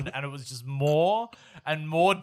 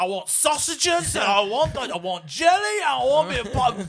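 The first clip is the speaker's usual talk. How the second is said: high, loud mock Cockney demands; Cockney kid; 10 semitones higher, 5 dB louder than usual. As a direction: holler like a Cockney market haggler in a child's body. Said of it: "this forty-year-old Cockney man haggling this".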